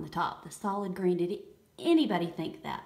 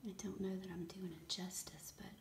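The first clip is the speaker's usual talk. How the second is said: breathy hushed whisper of wonder; awed whisper; 13 dB quieter than usual. Real, not acted. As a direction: whisper it as if afraid to break the spell. Awed whisper over bath bomb.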